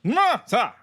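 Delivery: high, loud mock war cry; wrestler's shout. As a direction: belt it out like a wrestler.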